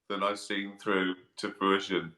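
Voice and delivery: ordinary talk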